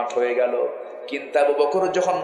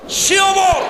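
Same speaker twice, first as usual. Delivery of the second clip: very high, loud shout; sudden full-force proclamation; thundering shout; 7 dB louder, much slower than usual. A narrator's reading. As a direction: cry out the name as a mighty climax. Climax on Omar's fearsome name.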